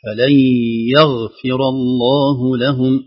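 يغفر الله لهم read very slowly; teacher modelling يغفر; slow model recitation. Shown as a demonstration. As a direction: model فلن يغفر for the student. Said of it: "أعيد مرة ثانية".

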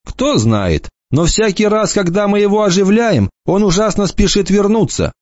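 light mocking boy's voice; lightly voiced cocky boy. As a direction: toss it off like a smug teenager. Enoch's voice.

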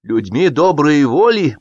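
cocky slow prompt; brazen prompting. A narrator's reading. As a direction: jump in cheekily to finish the phrase. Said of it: "поспешил на подмогу обнаглевший Кытин".

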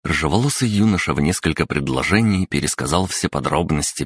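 usual speaking voice

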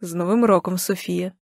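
lightly voiced man, subdued; soft, low-key male greeting, falling away; a hint of a quiet man; man's voice on phone. A narrator's reading. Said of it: "Чую чоловічий голос".

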